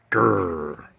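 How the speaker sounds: weak low growl; feeble growl. Acted. Slime trying to growl at the cats. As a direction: try a pitiful growl. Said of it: "Growl at them".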